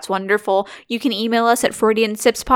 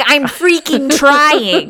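exasperated brain; 5 semitones higher, much slower than usual. Retold or imitated. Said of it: "And your brain's like".

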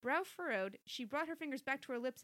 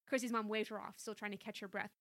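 ordinary delivery, nothing marked

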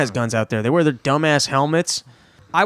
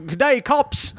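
higher, put-on British answering the phone; a cop's stiff British greeting, pitched up; attempted British accent; 8 semitones higher, slower than usual. Policeman answers Yosef's call in an attempted British accent. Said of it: "I don't think I can do a British accent".

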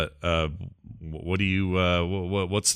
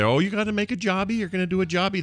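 higher-pitched imitation of mom; lightly voiced mom, pitched up; 9 semitones higher, faster than usual. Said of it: "So she'd say".

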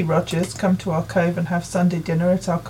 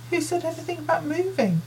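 surprised question, pitch high; 9 semitones higher than usual. Acted. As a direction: ask it in sudden surprise. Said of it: "asks Mum in surprise".

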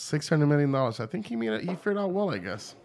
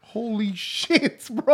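slow, high, stretched holy shit; drawn-out shock; 8 semitones higher, 4 dB louder, much slower than usual. Real, not acted. Friend reels from the paint-thinner smell, slow and disbelieving.

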